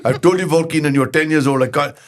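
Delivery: a hint of an exasperated shopkeeper; shopkeeper Ramesh. Shopkeeper refusing the boy, lightly voiced and firm. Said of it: "a wee boy Keenan comes in".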